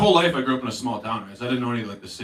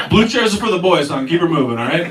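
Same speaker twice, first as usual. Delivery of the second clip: raised, higher-pitched ordering about; loud scolding; 4 semitones higher, 8 dB louder than usual. Retold or imitated. Rider shooing an old lady from the seats, loud scolding. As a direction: send them on their way loudly.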